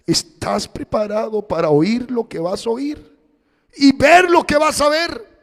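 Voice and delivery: rising to a loud, high shout; preacher's rising shout of warning; preaching shout. Acted. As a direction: thunder the warning at the congregation.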